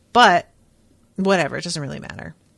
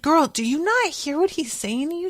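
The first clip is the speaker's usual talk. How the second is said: exasperated; 8 semitones higher than usual. Real, not acted. Speaker exasperated at character.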